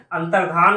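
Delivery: अंतर्धान as one word, "antardhaan"; correct pronunciation. Shown as a demonstration. Teacher giving students the right word, "dhaan" without y. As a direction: say अंतर्धान with no y-glide. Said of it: "क्या होता है?".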